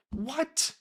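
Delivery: high, incredulous yelp of surprise; a sudden high squeak of shock; stunned what. Retold or imitated. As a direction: shoot the pitch way up in disbelief. Stunned reaction in retold conversation.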